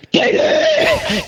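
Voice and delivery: very high imitated roar of rage; enraged Hulk. Husband leaping from the chair at wife, mock raging. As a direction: explode like the Hulk, hammed-up. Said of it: "came out of that chair like the Hulk".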